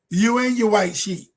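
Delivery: heated, defiant preaching rant; getting mad. Real, not acted. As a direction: push back hard and fired up. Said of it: "Can y'all tell I got mad?".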